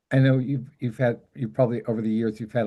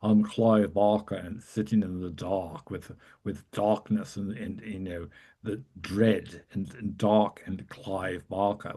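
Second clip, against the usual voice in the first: nasal, dark Clive Barker; 3 semitones lower, 4 dB quieter than usual. Retold or imitated. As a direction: brood about the dark like a gloomy horror writer. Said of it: "very nasal and very".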